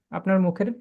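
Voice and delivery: normal talking voice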